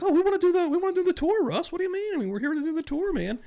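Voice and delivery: very high-pitched whiny protest; put-on innocent act, voice way up high; mock-innocent pleading. YouTubers insisting to Russ they came for the tour, mock-innocent.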